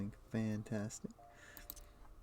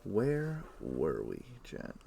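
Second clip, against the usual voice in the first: slow drawn-out repeat; 3 semitones lower, slower than usual. Acted. Streamer re-asking chat where they left off, slow and drawn out. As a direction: stretch every word out lazily.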